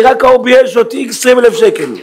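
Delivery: high, astonished exclamation; imitated amazed customer. Humiliated customer telling the rabbi of his windfall, amazed. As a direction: marvel at the windfall, pitched right up. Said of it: "ככה הוא אומר לי".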